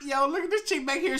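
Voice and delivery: high, amused call-out; amused exclaim. Real, not acted. Streamer spots a girl in the clip, high and amused. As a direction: point her out, amused.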